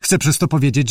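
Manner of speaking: ordinary talk, nothing marked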